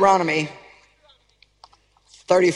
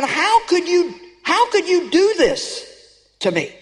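anguished plea; 6 semitones higher, slower than usual. Retold or imitated. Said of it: "you're kinda like saying".

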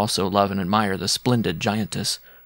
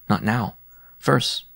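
usual speaking voice

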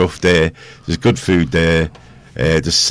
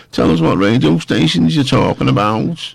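lightly put-on, higher-pitched voice; playful affected voice, pitched up; put-on silly voice; 7 semitones higher than usual. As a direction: ask it in a raised mock voice. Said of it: "talking in this voice".

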